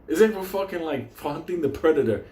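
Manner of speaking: normal talking voice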